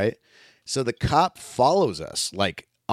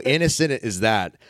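usual speaking voice

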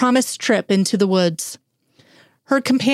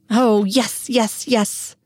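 eager yes; 3 semitones higher, much slower than usual. A narrator's reading. Eager acceptance.

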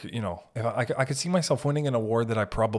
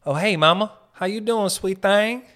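Southern flirt; 8 semitones higher, 7 dB louder than usual. Retold or imitated. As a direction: holler it like a Southern charmer. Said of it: "the power of".

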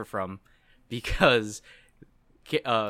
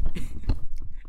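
raw scream of frustration; frustrated scream; 6 dB louder than usual. Real, not acted. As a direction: let out an exasperated scream. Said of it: "What was that scream?".

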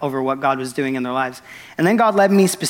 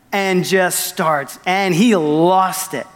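normal talking voice

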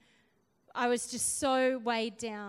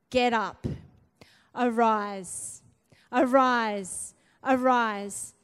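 loud command; 8 dB louder, much slower than usual. Retold or imitated. The Lord commands her.

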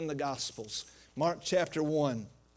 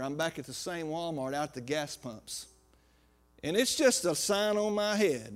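ordinary delivery

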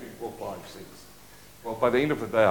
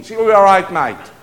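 put-on Aussie accent; 4 semitones higher, 10 dB louder than usual. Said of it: "You know that Aussie thing".

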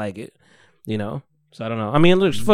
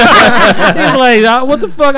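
higher, louder laughing; bursting out laughing mid-story; 9 semitones higher, 8 dB louder, slower than usual. Real, not acted. Laughing retelling.

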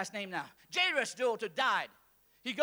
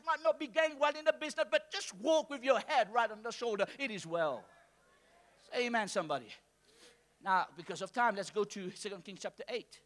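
usual speaking voice

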